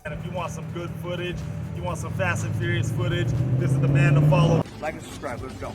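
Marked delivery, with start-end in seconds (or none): none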